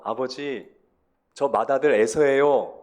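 loud, drawn-out acted claim to a father; Jacob claiming to be Esau. Jacob posing as Esau before father Isaac, loud pretend claim. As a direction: call it out slowly and firmly, imitating the deceiver. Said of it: "자기가 아버지 이삭 앞에서".

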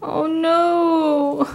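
long, high-pitched "oh no"; dismayed wail. Real, not acted. Fan finding a former member in the album, dismayed wail. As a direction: draw it out in mournful dismay. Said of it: "I wanna cry".

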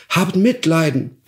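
begging. Acted. Dwarf begs.

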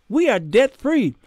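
normal talking voice

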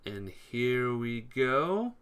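slow, stretched announcement; drawn out. Real, not acted. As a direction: drag out the go. Round starts again.

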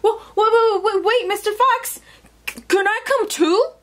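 high, stammering storybook animal; urgent calling. Acted. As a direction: call after someone, tripping over the words. Animal calls Fox to wait.

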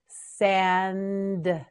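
"Sand" drawn out to a separate d; slow modelling. Shown as a demonstration. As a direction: stretch "Sand" and release the d. Teacher models word slowly.

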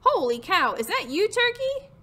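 high astonished question, swinging up at the end; surprised pig. Acted. Pig recognizing Turkey in costume, surprised. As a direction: exclaim it, ending on a high rise.

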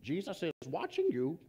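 a hint of a creepy unseen voice, hushed; creepy hidden voice. Parrot to thief in the dark, lightly voiced creepy voice. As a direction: spook the burglar from the dark. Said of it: "all of a sudden he heard".

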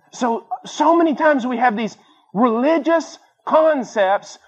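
high, slow, intense preaching; impassioned preaching. Real, not acted. Preacher to congregation, high impassioned preaching. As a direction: drive the point home with rising fervour.

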